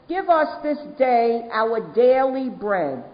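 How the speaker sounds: slow prayer line with "daily" leaned on and drawn out; stress on daily. Shown as a demonstration. Recovering alcoholic reciting the Lord's Prayer to the room, slow, with "daily" pressed. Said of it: "I emphasize the word daily".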